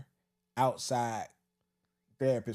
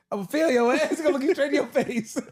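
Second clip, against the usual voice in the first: high, loud teacher's threat, cracking up; mock menace breaking into laughs; laughing mock threat; 11 semitones higher, 4 dB louder than usual. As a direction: imitate a tough teacher through laughter. Imitates teacher's threat while laughing.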